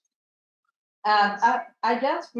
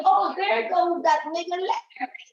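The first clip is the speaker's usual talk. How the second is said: mocking quote; 8 semitones higher than usual. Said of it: "They say".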